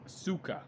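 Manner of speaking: Sokka softly as "SOO-kuh"; film's mispronunciation. Shown as a demonstration. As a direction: quote the wrong Sokka with a long "oo". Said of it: "They pronounce her name as".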